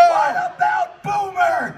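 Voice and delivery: high, slow, loud cry; mock desperate wail; pleading shout. Real, not acted. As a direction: plead for the pet. Pleading for the dog.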